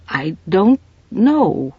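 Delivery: slow, hesitant I don't know; trailing off unsure; faltering. Acted. Mrs Polifax to Farrell, faltering and slow. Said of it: "she faltered".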